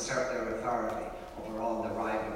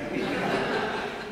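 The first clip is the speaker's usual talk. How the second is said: short unvoiced laugh; a light real chuckle; 5 dB louder than usual. Real, not acted. Laughing at childhood game.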